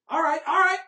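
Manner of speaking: loud, high cheer; excited cheer. Real, not acted. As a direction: cheer the play. Cheering a play.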